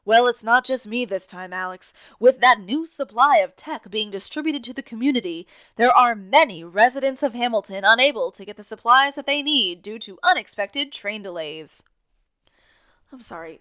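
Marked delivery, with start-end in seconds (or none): 0.0-11.8 loud, hammed-up news announcer; mock vaudeville newsreader, much louder than usual; maniac announcer voice. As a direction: sell the transit update like a frantic showman. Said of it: "do I have to do this voice? I sound like a maniac".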